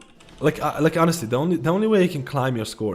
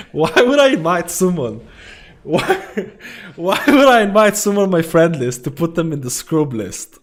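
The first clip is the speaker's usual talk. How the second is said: laughing disbelief; 4 semitones higher, 4 dB louder than usual. Real, not acted. Laughing incredulous question.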